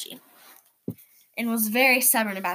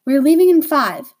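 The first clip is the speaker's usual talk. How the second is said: with authority; 4 semitones higher, 8 dB louder than usual. A narrator's reading. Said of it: "she said with authority".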